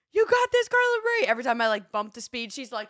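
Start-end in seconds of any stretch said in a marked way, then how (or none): 0.1-1.3 very high, loud cheering scream; imitated screaming instructor. Instructor filming her through the race, imitated screaming cheer. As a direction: scream encouragement across the gym. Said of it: "hear the one instructor ... whole time screaming".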